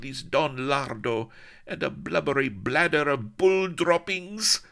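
put-on very high, loud, accented screaming; shrieking accented fury; furious tantrum. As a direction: rant wildly, stamping. Tantrum about betrothed.

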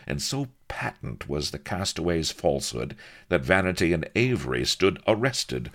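usual speaking voice

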